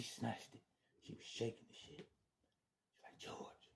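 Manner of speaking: near-whispered, breaking with emotion; choked whisper. Real, not acted. Remembering Tracy grabbing the phone, emotional whisper. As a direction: barely get it out.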